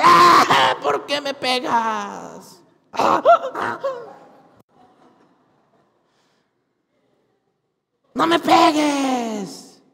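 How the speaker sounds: shouting donkey, slow and pained; donkey crying out. Acted. The donkey cries out to Balaam, pained shouting. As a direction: yell like a beaten donkey. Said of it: "la burra le dice".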